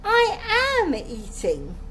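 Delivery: high, slow indignant insistence; indignant protest. A narrator's reading. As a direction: stretch out AM in protest. Accused girl insists she eats.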